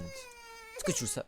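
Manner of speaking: very high, slow teasing voice; mock high voice. Teasing the living plush in a squeaky voice. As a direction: ask it in a squeaky put-on voice.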